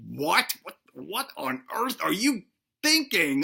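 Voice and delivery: stammering, baffled king; mock disbelief. King to self-maimed friend, acted incredulity. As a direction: play the dumbfounded reaction, repeating the first word.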